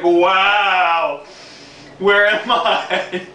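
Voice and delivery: slow, drawn-out amazed wonder. Retold or imitated. Rider offered a seat marvels slowly at his surroundings. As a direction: savor the disbelief slowly.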